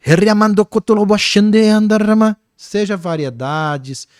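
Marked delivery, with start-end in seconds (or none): 0.1-2.4 higher, forceful run of tongues; elaborate tongues demonstration. Shown as a demonstration. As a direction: rattle off invented words with rolling force. Demonstrates elaborate tongues against simple syllables. (demonstração de línguas, aguda e enérgica)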